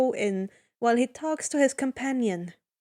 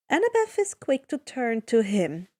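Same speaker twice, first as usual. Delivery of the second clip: ordinary delivery, nothing marked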